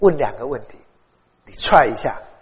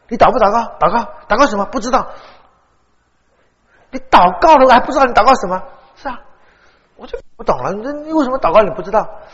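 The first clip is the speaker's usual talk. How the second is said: higher, louder disbelieving questions; incredulous questioning; 6 semitones higher, 6 dB louder than usual. Retold or imitated. Pastor retelling questioning his classmate about prayer, incredulous and louder. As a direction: press him in disbelief.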